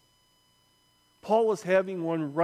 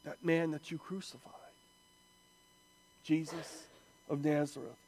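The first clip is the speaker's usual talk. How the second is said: soft, low, solemn; grave, subdued pronouncement; hushed gravity; 3 semitones lower, 9 dB quieter than usual. Real, not acted. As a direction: quietly press the point home, pausing. Solemn repeat of the answer.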